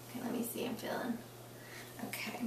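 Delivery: whispering. Real, not acted. Examiner mutters while palpating.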